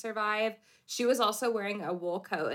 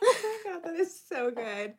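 laughing; 10 semitones higher than usual. Real, not acted. Reacting to a funny story.

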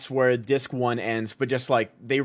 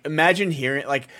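ordinary delivery

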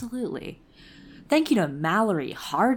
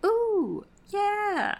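high squeal; 13 semitones higher than usual. Pretend. Reacting to patron name.

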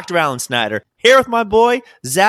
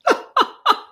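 high cackle. Retold or imitated. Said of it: "Pee Wee Herman show".

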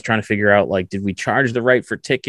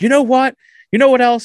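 high, loud heated challenge; retold angry yell; 7 dB louder than usual. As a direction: reenact a boiling-over argument. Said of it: "I was just getting so angry".

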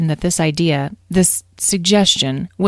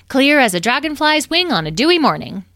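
bright-spirited, higher and louder with big swoops; cheerful; 10 semitones higher, 5 dB louder than usual. A narrator's reading. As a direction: chirp it eagerly, pitch bouncing high. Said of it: "said Edwin cheerfully".

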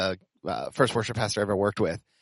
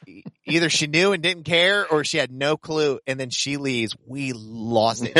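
normal talking voice

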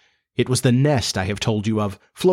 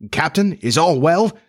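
timid, high-pitched question; mildly timid pirate; timidly; 6 semitones higher, 3 dB louder than usual. A narrator's reading. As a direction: ask the captain cautiously, voice lifted. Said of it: "they asked timidly".

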